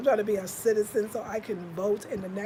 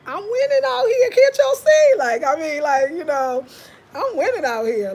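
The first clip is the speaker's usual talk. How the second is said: high, loud burst of triumph; loud exulting; 8 semitones higher, 14 dB louder than usual. Real, not acted. Telling viewers she is winning out here, loud exulting. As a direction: crow it out at full volume. Exulting over her life in Ghana.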